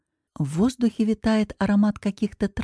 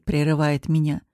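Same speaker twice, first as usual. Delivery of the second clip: ordinary delivery, nothing marked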